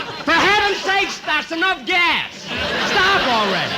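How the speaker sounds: high, loud exasperated outburst; blurted exasperation. Acted. Jack blurting at the gas pump, high and loud. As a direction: cry out over the gas gauge.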